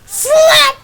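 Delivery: SLAP yelled, very high and loud; shouted slap. Retold or imitated. Retelling the skit, shouting the slap sound. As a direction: shout the slap like the hit lands. Punchline of bandit slap joke.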